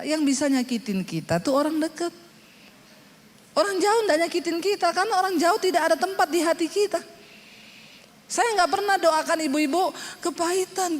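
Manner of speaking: ordinary talk, nothing marked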